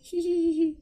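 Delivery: short high-pitched titter; squeaky little laugh; high giggle. Real, not acted. Laughs at the loading screen.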